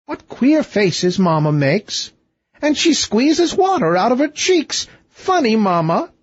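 delight in mamma's funny faces; giggly princess. A narrator's reading. Princess mocks her crying mother with high amused glee.

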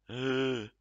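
sharp gasp. Real, not acted. Player gasping loudly before calling the chief. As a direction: gasp as if struck by an idea. Sudden idea in game.

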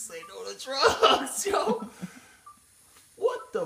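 laughing through a slogan. Real, not acted. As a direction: say it while cracking up. Mocking anti-drug slogan.

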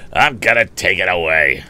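loud, put-on grudging growl; surly, forceful grumble; bully's growl. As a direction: growl it resentfully. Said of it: "growled Andy".

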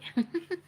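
short high-pitched giggle; a quick, bright amused laugh. Real, not acted. Amused at kid behind.

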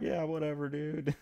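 high, soft mock brush-off; dismissive unruly women. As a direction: imitate women blowing off the boss. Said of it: "this unruly room of women that are like".

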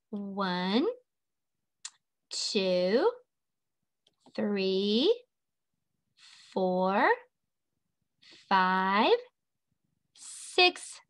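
slow count, every number lifting at the end; counting along. Shown as a demonstration. Teacher counting as she draws, slow rising count. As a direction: leave a pause after each rising number.

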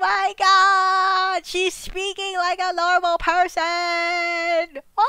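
high, drawn-out cry of astonishment; singsong shriek of disbelief; amazed wail. Real, not acted. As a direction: stretch each word into a high wail. Shocked at character speaking normally.

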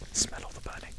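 hushed, breathy whisper of an afterthought; barely voiced, very soft aside; whispered echo. Real, not acted. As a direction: whisper it under your breath. Repeats own words in whisper.

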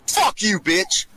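ordinary talk

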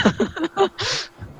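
high squealing laugh; 高声笑. Real, not acted. 自嘲会被打死. (尖声大笑)

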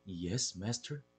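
soft, entranced murmur, as if under a spell; hypnotized murmur. Acted. Harold murmurs to his hypnotist cat, entranced and soft. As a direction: let the words slip out dazed and low. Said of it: "an inexplicable urge to murmur".

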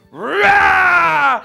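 sudden loud, high roar; wolf roar. Acted. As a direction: pounce with a big roar. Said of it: "And he went".

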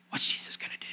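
whispered in suspense; the crowd whispering, waiting on Jesus; hushed whisper. Acted. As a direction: whisper it like the whole room is holding its breath. Crowd wondering in hush.

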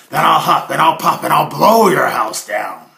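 loud menacing threat; threatening wolf. Acted. Wolf threatens Herman's wooden house, loud. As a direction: bellow the threat. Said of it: "The wolf said".

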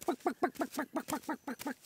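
high, soft staccato clucks; quiet mock chicken noises; chicken clucking. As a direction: imitate a chicken, quick little high-pitched bucks. Imitates chickens walking on two legs.

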